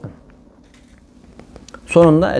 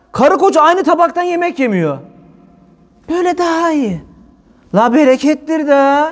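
preaching at full force; 8 semitones higher than usual. Real, not acted. Preacher urging the congregation to share plates, fervent. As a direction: preach it with fire.

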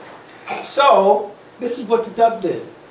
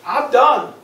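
louder, higher acted outburst; upset pigeon; 7 semitones higher, 4 dB louder, much slower than usual. Pigeon giving up on the duck, upset. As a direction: blow up like a fed-up pigeon. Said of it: "He's really upset".

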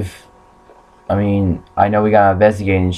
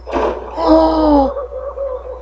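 high, drawn-out startled gasp; real gasp. Real, not acted. Gamer shocked by what the game shows, gasping.

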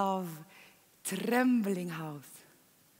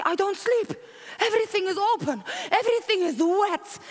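excited longing; 9 semitones higher, 6 dB louder, faster than usual. Retold or imitated. She tells lover of her longing.

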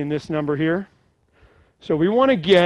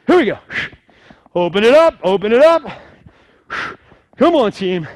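loud coach cheer; shouting; 3 semitones higher, 6 dB louder than usual. Real, not acted. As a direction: pump up the class. Fitness instructor hyping class.

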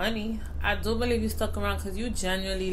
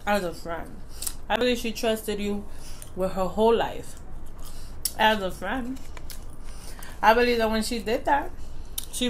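talking while eating; speaking between bites; mouth full, chewing. Real, not acted. Seen 1: Hand brings food to mouth, then chews; straw not used.

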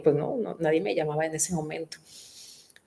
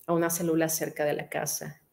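usual speaking voice

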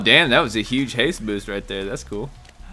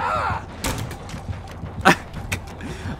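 high, breathless laughing fit; real laugh; 5 dB quieter than usual. Real, not acted. Gamer laughing at the failed play, real laugh. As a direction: laugh helplessly at your own failure.

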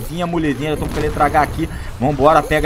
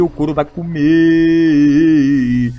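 very slow, louder drawl; stretched hype; 4 dB louder, much slower than usual. Real, not acted. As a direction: drag out the hype. Hype catchphrase.

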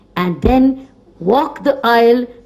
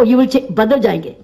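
ordinary delivery

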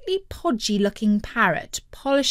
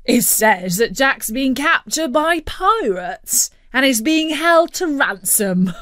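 laughing as he speaks; louder, lifted voice on the edge of laughter; amused dad; 4 semitones higher, 4 dB louder than usual. A narrator's reading. Dad reads out the ransom news, laughing. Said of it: "he started to laugh".

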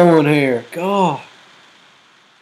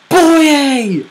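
high, louder cry of the name; excited shout; 9 semitones higher, 5 dB louder than usual. Real, not acted. Commentating as the striker shoots, excited shout.

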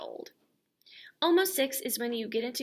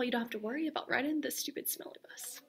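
whisper; 2 semitones higher, 6 dB quieter, faster than usual. Real, not acted. Teacher jokes to kids about bus.

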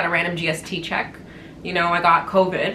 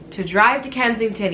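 ordinary talk, nothing marked

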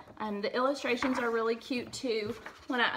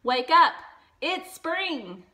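louder with a high lift; shouting; 6 semitones higher, 9 dB louder, slower than usual. A narrator's reading. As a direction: rouse a sleepy friend. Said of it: "shouted Frog".

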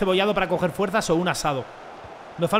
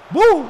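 high, loud goal cry; loud celebratory bu; 10 semitones higher, 10 dB louder than usual. Real, not acted. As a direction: burst out with a victory shout.

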